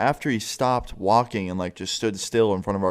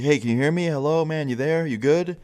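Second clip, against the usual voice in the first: higher-pitched retold hailing, questioning; calling out; 5 semitones higher than usual. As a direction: call as if snapping someone out of a daze. Friend tries to rouse dazed man.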